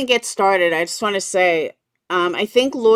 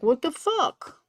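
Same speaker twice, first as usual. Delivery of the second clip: slow, higher puzzled imitation; confused viewer; 7 semitones higher, 5 dB quieter, slower than usual. Viewers finding the wrong stream, mock-confused and slow. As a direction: play a baffled viewer, slowly. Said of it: "be like".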